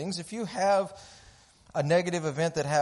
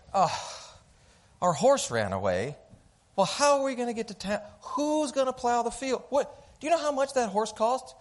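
high, fast, fretting mother-in-law voice; frantic mother-in-law; 9 semitones higher than usual. Retold or imitated. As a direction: panic over every cost like an anxious in-law. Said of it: "I am talking about my mom-in-law".

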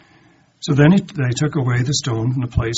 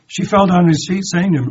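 louder and higher, pressing the words; emphatic repeat; 6 semitones higher, 11 dB louder than usual. A narrator's reading. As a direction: drive the verse home to the congregation. Preacher repeats verse with force.

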